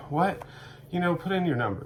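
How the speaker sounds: put-on high, puzzled "what?" from the kid at the till; baffled cashier. Cashier baffled that the customer refuses the discount. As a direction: play the confused teenage cashier.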